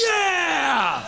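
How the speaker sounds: high shouted cheer; crowd cheer. Retold or imitated. Everyone in the club cheering Ken, high shouted yeah. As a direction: roar it like a whole room.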